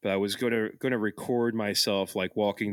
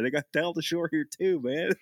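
put-on Delco accent; 5 semitones higher than usual. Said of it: "I kept saying it in the pool".

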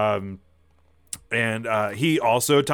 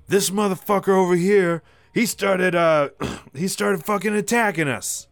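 higher, louder indignant rant; angry complaint; 9 semitones higher, 5 dB louder than usual. Acted. Brave Matthews to the security guard, loud angry accusation. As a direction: blame him loudly. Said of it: "he's like".

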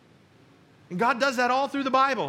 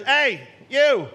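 parrot calling out; 3 semitones higher, 7 dB louder than usual. Acted. Parrot in the pet store hails the returning man, loud and cheeky.

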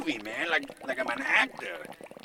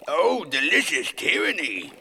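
slow, loud, hammed-up relish; savoring every word; 7 dB louder than usual. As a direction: chew on the words like food. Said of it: "make it sound like I'm really chewing on something".

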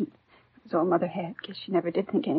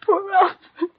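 breaking down; 10 semitones higher, 8 dB louder than usual. Acted. Widow breaks down over husband.

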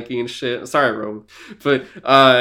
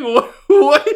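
mock disbelief; 11 semitones higher, 7 dB louder than usual. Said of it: "I was like".

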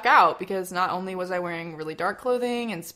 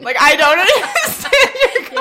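words breaking up in laughter, higher and louder; laughing; 11 semitones higher, 10 dB louder than usual. Real, not acted. Daughter and mother both crack up at the bullies' taunt, laughing. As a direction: laugh through the line.

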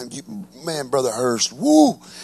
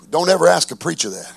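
ordinary delivery

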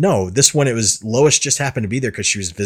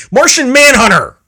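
much higher and louder, thrilled cry; fanboy yelling the character's name; excited shout; 9 dB louder than usual. Real, not acted. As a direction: blurt the name out in fan delight. Fan hyped about the movie.

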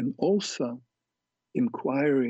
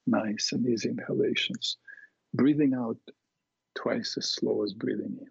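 guided meditation. Acted. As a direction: guide them calmly through it. Leads a guided imagery exercise.

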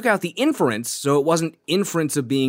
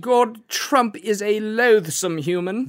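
slow, high, hammed-up English accent; mock olde english; 7 semitones higher, slower than usual. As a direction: ham it up like a Shakespearean player. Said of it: "English accent ... Shakespearean voice".

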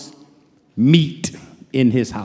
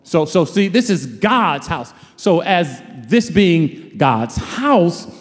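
ordinary talk

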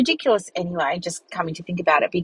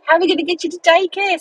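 high, hurried mock fretting; retold dismay; 9 semitones higher, faster than usual. Owner fretting over getting her dog to daycare, retold dismay. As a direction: replay a worried dog owner's thoughts, pitch climbing. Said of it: "And I thought".

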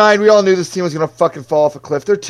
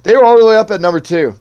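ordinary talk

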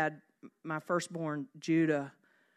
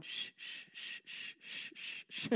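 very soft repeated shushes; hushed, breath-only shushes; gentle divine hushing; 16 dB quieter, slower than usual. Acted. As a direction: soothe with quiet, steady shushing. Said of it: "hearing the Lord going".

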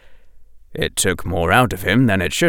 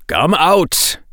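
a firmer, harder "Come out"; 6 semitones higher, 3 dB louder than usual. A narrator's reading. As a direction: say "Come out" as a hard command. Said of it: "making his voice harder".

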